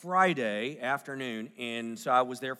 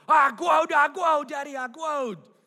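put-on high, loud little-girl shout; top of her lungs; 11 semitones higher, 6 dB louder, slower than usual. Daughter held in dad's arms after his trip, high joyful toddler shout. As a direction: belt it out like an overjoyed toddler. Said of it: "at the top of her lungs".

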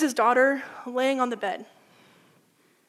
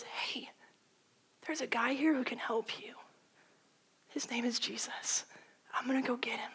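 soft whisper by a sickbed; tender whisper; 9 dB quieter than usual. Retold or imitated. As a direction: promise it softly to a sick child. Father whispers at bedside.

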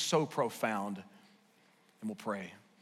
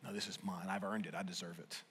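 low, much softer possessive murmur; quiet self-justifying voice, dropping away; hushed mock greed; 8 semitones lower, 11 dB quieter than usual. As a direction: mutter it like a hoarder. Retold greedy inner voice.

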